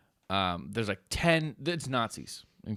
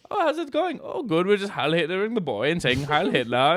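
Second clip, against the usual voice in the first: mock cheery voice; 8 semitones higher, 6 dB louder than usual. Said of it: "and I go".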